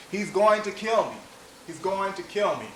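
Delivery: urgent retold yell, pitched well up; shouting and yelling. Prosecutor tells jury how she ran toward officers, shouting. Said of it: "she was shouting and yelling".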